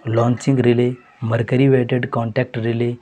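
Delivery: ordinary talk, nothing marked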